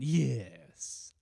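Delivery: loud, drawn-out theatrical word; hammed-up stagey host; affected staff voice. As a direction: stretch it out grandly. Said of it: "they speak like this".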